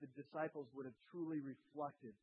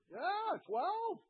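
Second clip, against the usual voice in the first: much higher, small mock voice of a caught disciple; sheepish disciple; 7 dB louder than usual. Disciples answering Jesus in a high sheepish voice. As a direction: squeak it out like a caught schoolboy.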